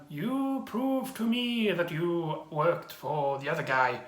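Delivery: slow, bored monotone delivery; mock bored old man, dragging the words; bored old monk. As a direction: recite it like an uninterested bad actor. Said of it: "he's so clearly bored".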